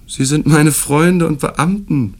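dismissive interruption. A narrator's reading. Host cuts off the guest's question, stiff dismissive retort. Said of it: "fiel mir Herr Maillard ins Wort und richtete sich abweisend".

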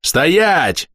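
high-pitched drawn-out order; restrained narrated shout, stretched vowel; shouted command. A narrator's reading. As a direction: call out to halt someone walking away. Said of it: "крикнул я ей в спину".

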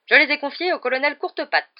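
booming country aunt; big booming voice. A narrator's reading. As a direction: answer in a big, hearty voice. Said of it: "de sa grosse voix".

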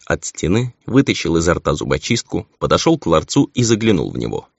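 ordinary delivery

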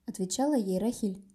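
usual speaking voice

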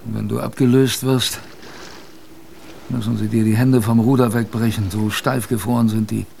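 usual speaking voice

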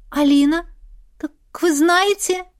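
high startled question; shocked she knows the name; surprised. A narrator's reading. Heroine stunned she knows. (удивлённый вопрос)

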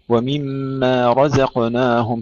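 slow chant, رزقناهم with a full, heavy ر. Shown as a demonstration. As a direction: thicken the ر in رزقناهم, chanting slowly. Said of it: "فخم الراء أكثر". (ترتيل «رزقناهم» بتفخيم الراء)

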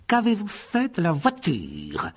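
aigu, lent, menaçant; Rogue menaçant. A narrator's reading. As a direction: interroger avec une lenteur glaciale. Professeur interroge froidement. (professeur menaçant)

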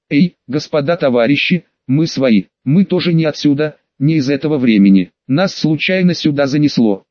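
ordinary delivery

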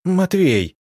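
high, indignant appeal with falling end. A narrator's reading. Арина appealing to Матвей during a squabble, indignant. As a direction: call the name out in high-pitched protest. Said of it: "возмутилась Арина".

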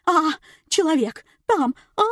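high, loud, gasped-out alarm; panicked shrieking. A narrator's reading. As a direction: shriek in fright at what you see. Frightened woman shrieks at the hand. (панический визг)

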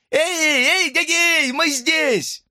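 very high, sustained calling; shouting for help. A narrator's reading. Hamster calling through the bars, shouting high. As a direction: call to rescuers at the top of the range. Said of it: "и закричал".

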